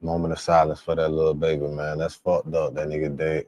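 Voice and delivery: sincere mourning. Real, not acted. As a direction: pay respects quietly. Mourning the murdered child.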